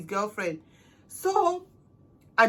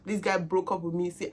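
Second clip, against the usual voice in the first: ordinary talk, nothing marked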